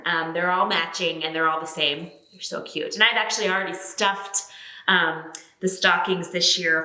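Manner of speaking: usual speaking voice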